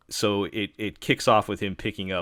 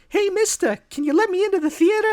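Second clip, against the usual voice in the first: put-on much higher, loud New York newsboy girl; a hammed-up New York newsboy girl begging at the theater door; New York newsboy accent; 10 dB louder than usual. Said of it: "nineteen thirties New York newsboy accent".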